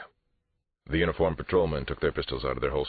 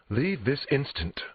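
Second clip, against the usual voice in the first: high, slow, imperious dismissal; indignant order; 11 semitones higher, slower than usual. Acted. Therapist ordering police out, indignant. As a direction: order them out, word by word.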